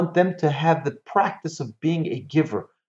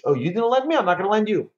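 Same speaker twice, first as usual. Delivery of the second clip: higher-pitched tit-for-tat retort; mock vengeful refusal; 6 semitones higher, faster than usual. Teacher voicing someone taking revenge on a neighbour, mock vengeful. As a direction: refuse them in a raised, pettily vengeful voice. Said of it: "Don't take revenge".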